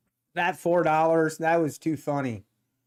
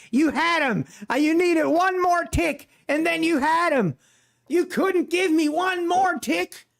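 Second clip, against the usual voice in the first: imitated outrage, pitched way up; mock angry complaint; 11 semitones higher than usual. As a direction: complain in a high, exasperated mock rant. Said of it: "Darrell is mad".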